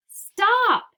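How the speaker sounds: put-on a loud, high, strained old man's yell; shouted. A narrator's reading. Grandpa Joe shouting at Wonka over the crash, loud high yell. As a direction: bellow stop in alarm, high and loud. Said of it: "shouted Grandpa Joe".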